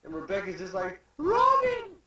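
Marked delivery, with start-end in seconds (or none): 1.2-2.0 very high, loud acted cry; desperate call. Rebecca calling to Robin, high acted scream. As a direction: scream the name out.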